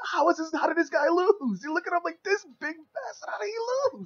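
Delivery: very high-pitched incredulous voice; mock disbelief. Baffled fan watching the big man lose, incredulous. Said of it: "And you're like".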